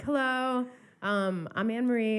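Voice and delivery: mock singer greeting. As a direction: introduce yourself with a high chirp. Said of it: "She's like".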